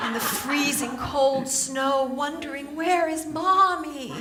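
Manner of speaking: slow, drawn-out coddling mother voice; guilt-tripping mom gnome. Acted. Mom gnome nags working mother about her boy, slow guilt-trip. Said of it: "this little mom gnome".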